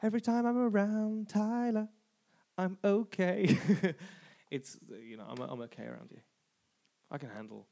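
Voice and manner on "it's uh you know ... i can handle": hushed aside. Pretend. Mock flustered confession.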